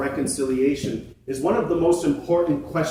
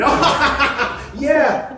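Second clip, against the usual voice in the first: much higher, laughing "oh yeah"; gleeful laugh; 11 semitones higher, 5 dB louder than usual. Acted. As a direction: laugh with greedy delight over the berries. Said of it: "started rubbing his belly".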